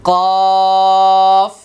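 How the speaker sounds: কফ loud and high, thick from the back of the throat; heavy letter demo. Shown as a demonstration. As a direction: punch কফ heavy without rounding the lips. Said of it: "কোনো ধরনের গোল হবে না".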